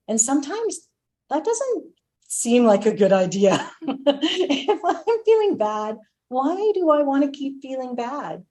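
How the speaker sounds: high, laughing disbelief; amused high-pitched question. Real, not acted. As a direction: laugh it off as you ask.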